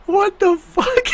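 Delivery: high drawn-out laughing cry; laughing disbelief. Real, not acted. Shocked at game.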